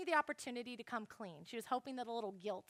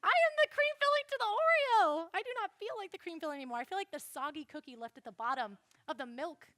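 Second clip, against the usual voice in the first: high, loud melodramatic wail; hammed-up heartbreak; 6 semitones higher, 6 dB louder than usual. Daughter mocking her own guilt after mom's scolding, hammed-up melodrama. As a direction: ham it up like a tragic heroine. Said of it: "I'm like".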